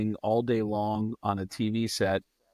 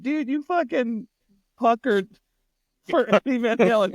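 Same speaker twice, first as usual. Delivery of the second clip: high, loud retold ribbing; gleeful teasing; 13 semitones higher, 4 dB louder than usual. Ribbing a friend who puckered for a rock star, gleeful. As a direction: crow at your friend in amused disbelief.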